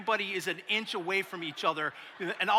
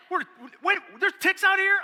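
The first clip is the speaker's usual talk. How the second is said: high, loud panicked squeal; freaked out; 13 semitones higher, 8 dB louder than usual. Retold or imitated. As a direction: yelp it like a scared city guy. Guys freak out about ticks.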